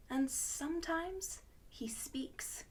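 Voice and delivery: breathy storyteller's whisper. A narrator's reading. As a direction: drop to a secretive whisper.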